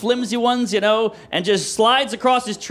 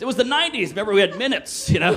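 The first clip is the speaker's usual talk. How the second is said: ordinary talk, nothing marked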